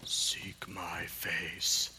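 very slow, low, breath-only cue; slow whispered prompt. Shown as a demonstration. Pastor feeding a child the next line, slowly whispered. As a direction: whisper each word drawn out.

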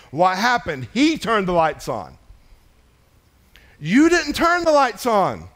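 loud, high, drawn-out scripture proclamation; full-force preaching. Real, not acted. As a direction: proclaim it to the whole church, slow and loud.

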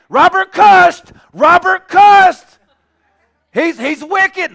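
high, drawn-out hollered accusation; mock tattling shout. Running to tell the church on Robert, mock tattling shout.

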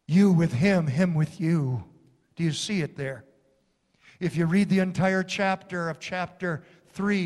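normal talking voice

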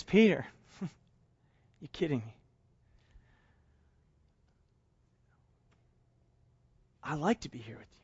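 soft, low, gentle answer; quietly loving; tender reassurance. Acted. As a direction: comfort a friend with a smile. Jesus gently reassures Peter.

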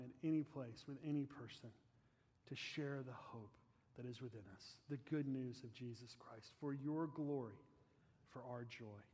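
softened benediction prayer; closing prayer. Real, not acted. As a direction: pray to the Father quietly, fading at each line's end.

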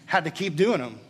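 rising preacher's emphasis, building force; earnest sermon delivery, pressing each point; preaching. Acted. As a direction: preach it with full conviction from the pulpit. Preaching the sacrifice message.

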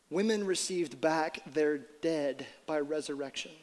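slow, soft scripture reading. Shown as a demonstration. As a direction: let each image land slowly. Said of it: "I wanna read 'em slowly".

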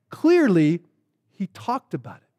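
normal talking voice

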